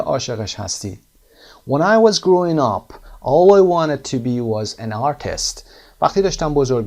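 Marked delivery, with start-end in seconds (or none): 1.7-5.6 louder, higher, slow reading; read aloud. Acted. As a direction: read the text aloud for the class. Reading the blog text aloud.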